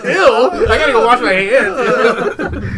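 laughing mock disgust. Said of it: "retold disgust".

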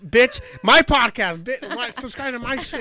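loud, high mock outrage; yelling as if insulted; mock angry rant. Imitating Jackie yelling at a listener, mock outrage.

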